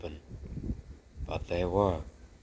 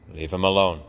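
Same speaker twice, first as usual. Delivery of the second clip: louder, firm command; stern father mouse; 10 dB louder than usual. Acted. Father telling the children to leave the baby, loud and firm. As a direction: order the children back.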